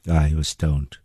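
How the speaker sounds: normal talking voice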